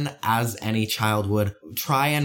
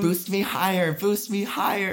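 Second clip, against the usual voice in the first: put-on higher kid chant; eager kid voice; 7 semitones higher than usual. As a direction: beg like a thrilled child. Voicing eager kids.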